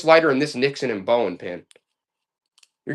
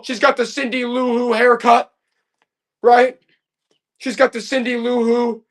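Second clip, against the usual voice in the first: spooked excitement; 7 semitones higher than usual. Real, not acted. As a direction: act freaked out by the doll, pitch way up. Creepy doll pulled out, spooked.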